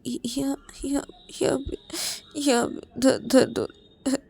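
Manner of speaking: tearful. Acted. Payal crying, showing where it hurts.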